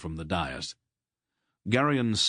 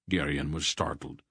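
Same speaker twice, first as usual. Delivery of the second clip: ordinary delivery, nothing marked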